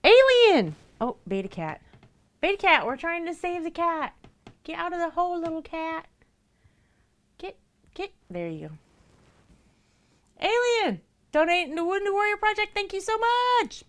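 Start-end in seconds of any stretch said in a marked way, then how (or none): 0.0-0.8 very high, loud shout of a name; calling out. Real, not acted. Calling another cat by name. 2.4-8.1 much higher, excited coaxing; coaxing the cats. Real, not acted. Coaxing cats to rescue one. 10.4-11.0 very high, loud shout of a name; calling out. Real, not acted. Calling the cat again. 11.3-13.8 excited thanks. Real, not acted.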